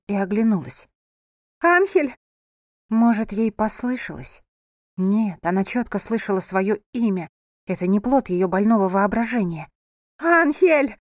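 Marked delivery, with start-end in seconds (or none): 1.6-2.1 high, loud call of a name. A narrator's reading. Wife searches for her husband, calling out. 10.2-11.0 high, loud urgent call. A narrator's reading. Wife searches for her husband, urgent calling out.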